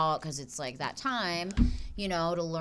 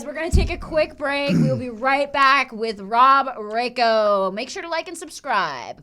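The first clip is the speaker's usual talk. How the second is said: loud, upbeat break announcement; show host sign-off; 5 semitones higher, 8 dB louder than usual. Acted. Hosts tell viewers they take a break.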